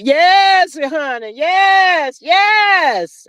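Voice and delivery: a high, emphatic hollered "yes" in his style; lightly imitated Carlos King catchphrase. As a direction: belt it out like the TV producer, stretched and shouting. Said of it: "In my Carlos King voice".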